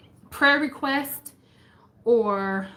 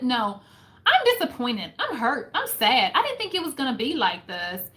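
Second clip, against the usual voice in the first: normal talking voice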